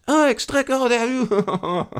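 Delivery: high exclamation in mock French accent. Board member seizing on the new name, excited French accent. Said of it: "should I do the French accent".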